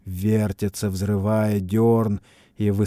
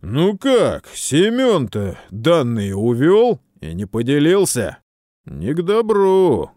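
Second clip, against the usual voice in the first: higher, louder soldier's voice; 6 semitones higher, 6 dB louder, slower than usual. Acted. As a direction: speak up like a worried soldier. Блоха answers the commander.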